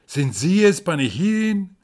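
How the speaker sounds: usual speaking voice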